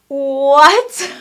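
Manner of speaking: loud rising gasp of a what; shocked what. Retold or imitated. Woman hearing her vision repeated, loud shock. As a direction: blurt it in total shock. Stunned by husband's words.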